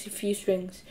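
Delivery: ordinary delivery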